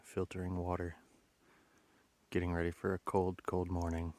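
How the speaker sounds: low, soft documentary narrator; hushed wildlife-film narration; nature documentary. Acted. Through hiker in natural habitat.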